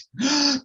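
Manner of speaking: high theatrical intake of breath; shocked gasp. Acted. Posh lady accused by her friend, shocked gasp. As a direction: gasp as if scandalised.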